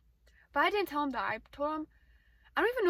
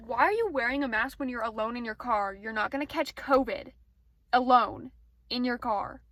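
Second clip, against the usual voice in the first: exasperated rant; 9 dB louder than usual. Real, not acted. Ranting at masked drivers.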